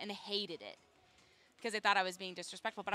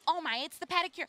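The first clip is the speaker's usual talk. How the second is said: higher, louder mock exclamation; mock excited fan; 8 semitones higher, 6 dB louder than usual. Fans tweeting at the host about pedicures, mock excited. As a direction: play an overexcited fan tweeting.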